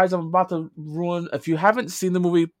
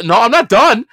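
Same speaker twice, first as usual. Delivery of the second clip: louder, firmer "not done"; insistent; 4 semitones higher, 7 dB louder than usual. Real, not acted. Talking over his cohost who cuts in, loud insistence. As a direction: push back over the interruption.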